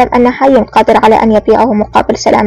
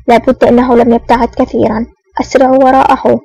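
ordinary delivery

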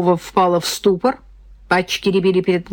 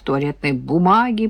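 plain reading voice

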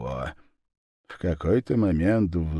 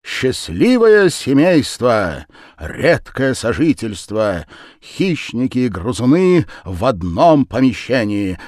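poster announcement; 4 semitones higher, 6 dB louder than usual. A narrator's reading. Reading the showman's poster.

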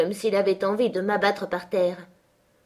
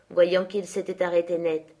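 ordinary delivery, nothing marked

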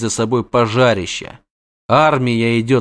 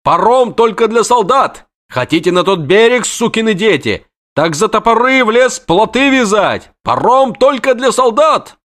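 roaring; 11 semitones higher, 4 dB louder than usual. Acted. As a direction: roar them back from the ferry. Rider roaring at crowd.

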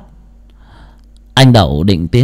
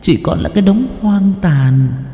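ghost mother; 7 semitones higher than usual. Acted. Ghost mother instructs son in dream.

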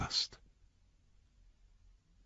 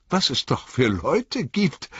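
indignant exclamation; 10 semitones higher than usual. A narrator's reading. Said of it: "rief Frodo".

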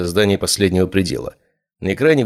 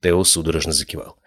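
plain reading voice

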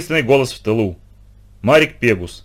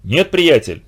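usual speaking voice

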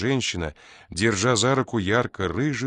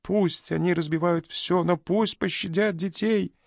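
very high sobbing cry; begging wildly through tears; frantic sobbing plea; 10 semitones higher than usual. A narrator's reading. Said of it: "иступлённо закричала она, рыдая".

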